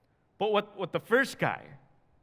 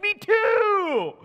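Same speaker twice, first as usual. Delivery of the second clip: squeaky, much higher eager cry; delighted; 9 dB louder than usual. Retold or imitated. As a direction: chirp it out, thrilled to agree. Delighted at shared faith.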